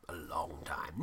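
low breathy whisper. Retold or imitated. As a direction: whisper it like a fading echo. Echoed Star Wars line.

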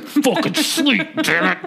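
high, slow mock fury; angry in sleep. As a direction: growl it like a grumpy sleeper. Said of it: "You're angry in your sleep".

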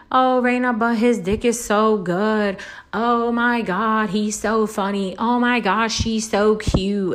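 hammed-up swooning, drawn out; mock swooning girl. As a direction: gush like a lovestruck girl. Mocking a smitten woman.

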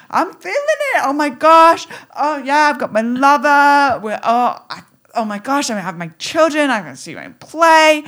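high, loud ecstatic gushing; excited. Pretend. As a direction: gush with giddy joy. Imagining joy in parking lot.

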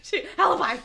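loud, high mimicked blurt; mock yell. Mocking co-host's guess, laughing yell. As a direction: shout it mid-laugh as a parody.

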